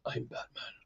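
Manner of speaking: low, voiceless Batman growl; hushed gravel-throated hero line; Michael Keaton Batman. Retold or imitated. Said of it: "any of Michael Keaton".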